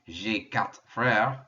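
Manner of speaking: "j'ai quatre frères" slow, R of "quatre" dropped; French sentence. Shown as a demonstration. Teacher shows "quatre" in a sentence, slow and careful. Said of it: "you don't even hear the R".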